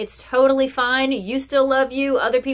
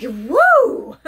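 loud, high whoop of delight; a bright whooping cheer; excited woo; 7 semitones higher, 6 dB louder than usual. Retold or imitated. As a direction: cheer it out, thrilled. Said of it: "And I was like".

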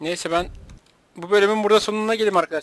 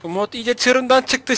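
ordinary talk

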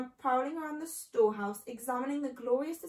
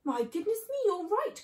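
high-pitched sudden realisation; surprised cry; 9 semitones higher, 3 dB louder than usual. A narrator's reading. Mister Fox agreeing with his small son, surprised cry. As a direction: exclaim it higher, struck by the thought. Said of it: "cried Mister Fox".

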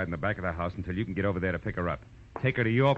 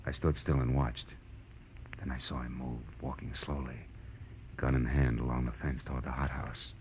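noir narration; 3 semitones lower than usual. A narrator's reading. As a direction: spin the tale like a hard-boiled private eye. Detective narration over music.

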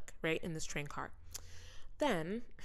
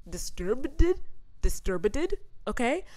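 Disturbed said high and loud with a rising tune; emphatic disbelief; 7 semitones higher, 6 dB louder, slower than usual. Real, not acted.

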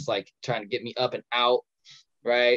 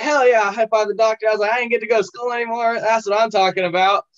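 much higher, louder whooping glee; thrilled cheer; 10 semitones higher, 5 dB louder than usual. Retold or imitated. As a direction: celebrate like school's cancelled forever. Kid thrilled about skipping school.

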